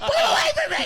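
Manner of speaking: very high mock-terrified child; hammed-up frantic child scream; screaming kids. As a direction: squeal in panic, imitating a frightened kid. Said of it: "the kids started screaming and crying".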